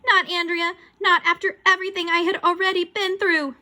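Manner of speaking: high, loud wail of dismay; horrified protest. Acted. Boy despairs at the ghost's identity, loud protest.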